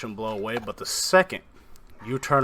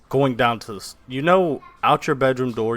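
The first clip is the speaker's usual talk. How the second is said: ordinary delivery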